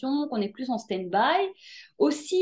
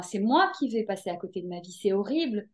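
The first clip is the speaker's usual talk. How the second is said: usual speaking voice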